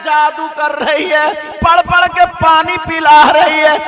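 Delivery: high, very loud drawn-out chanting cry; ringing, sustained preacher's chant; full-force preaching. Real, not acted. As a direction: preach it at full volume, stretching key words.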